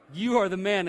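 loud, admiring praise, like a buddy's high five; cheering compliment. Shown as a demonstration. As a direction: cheer him on, you are the man. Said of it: "And it's not the good way".

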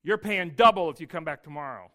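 the tax collector rebuking a man who can't pay; harsh tax collector. Retold or imitated. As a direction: threaten him coldly. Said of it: "And the tax collector says".

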